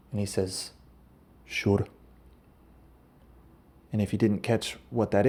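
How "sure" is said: a brief, laconic Scottish "sure"; Scottish accent. Acted. Jacked Scottish driver agreeing to the request, in a light Scottish accent. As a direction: answer in a light Scottish burr. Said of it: "that's a Scottish accent".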